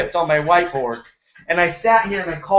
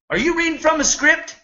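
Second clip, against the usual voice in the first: mocking caller; 10 semitones higher than usual. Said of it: "He said".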